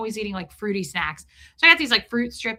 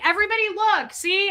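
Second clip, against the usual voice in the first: high, urgent appeal to everyone; mock desperation; 9 semitones higher than usual. Embarrassed passenger appealing to seatmates, mock desperation. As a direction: call the cabin's attention, frantic. Frantically proving film family friendly.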